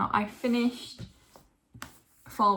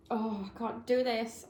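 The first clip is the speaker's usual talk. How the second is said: normal talking voice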